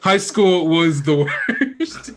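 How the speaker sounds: talking through laughter; laughing. Real, not acted. Player joking about his character's name, laughing hard. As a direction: laugh your way through the words.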